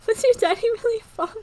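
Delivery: put-on high, loud childlike voice through laughter; little girl voice. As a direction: ask it like a giggling small girl. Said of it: "she asked me".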